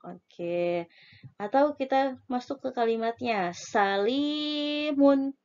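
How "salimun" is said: salimun with li stretched long; drawn-out long vowel. Shown as a demonstration. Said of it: "Berarti yang panjang adalah li-nya".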